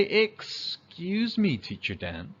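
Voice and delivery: slow, gentle polite ask; polite request. Shown as a demonstration. Kid politely getting Teacher Dan's attention, slow gentle ask. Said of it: "we say".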